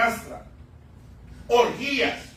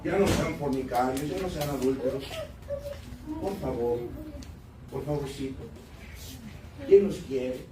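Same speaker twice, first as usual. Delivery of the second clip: low, soft and sugary, begging sinners sweetly; extra soft preaching; 7 semitones lower, 8 dB quieter than usual. Shown as a demonstration. Pastor mocking soft preaching to his church, low and sugary. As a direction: coax them like you're afraid to offend. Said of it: "te lo voy a dejar más suavecito".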